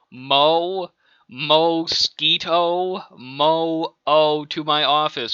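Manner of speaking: slow, drawn-out intercom announcement; telecom page. Acted. Boss paging Mo to his office, drawn-out announcement. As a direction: page him, stretching each name out.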